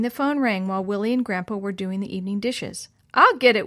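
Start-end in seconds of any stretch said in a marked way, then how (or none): 3.1-3.7 raised, louder shout; calling out. A narrator's reading. Willie shouts that he'll take the ringing phone, calling out. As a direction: call out to answer the phone. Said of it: "Willie shouted".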